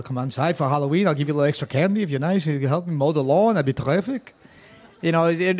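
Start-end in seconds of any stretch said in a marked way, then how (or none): 0.0-4.3 quick, lightly voiced sweet old neighbor; a hint of a friendly Queens lady offering candy. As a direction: coax the kids in, mildly imitated.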